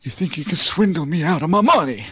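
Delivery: lightly husky Godfather-style mobster; a hint of a menacing mob boss. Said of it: "when I think, like, mobsters, I think of the guys like, the, the Godfather".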